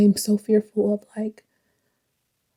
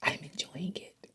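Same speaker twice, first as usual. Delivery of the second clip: tearful whisper; 5 semitones lower, 18 dB quieter than usual. Real, not acted.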